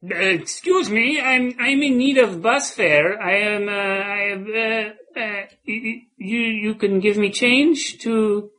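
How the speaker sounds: high, halting stammering panhandler; hesitant beggar voice. Acted. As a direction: stammer out a shy plea for bus fare. Improvised beggar character asking fare.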